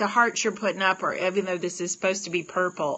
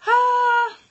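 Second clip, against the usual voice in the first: long, very high, loud excited "ah"; high loud squeal; 10 dB louder than usual. Real, not acted. Delighted reaction to heart color.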